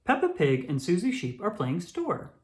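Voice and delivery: ordinary delivery, nothing marked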